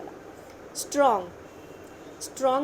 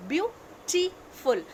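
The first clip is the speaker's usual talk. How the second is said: "beautiful" stretched very slowly, each syllable spaced; teacher slowing "beautiful" down to show its three syllables; drawn out by syllable; 6 semitones higher, much slower than usual. Shown as a demonstration. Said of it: "three syllables are there".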